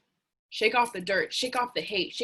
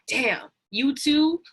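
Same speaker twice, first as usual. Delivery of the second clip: higher, drawn-out "damn, you too"; surprised recognition; 6 semitones higher, 4 dB louder, much slower than usual. Retold or imitated. Friends realizing they all struggle, slow surprised exclaim. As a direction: stretch it out, relieved to find company.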